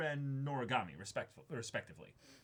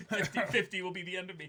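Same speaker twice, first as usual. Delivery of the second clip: talking through real laughter, a bit fast; laughing; 6 semitones higher, 4 dB louder, faster than usual. Real, not acted. Reader cracking up over the letter's wording, laughing. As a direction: giggle out the words, cracking up. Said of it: "oh no, I'm dying".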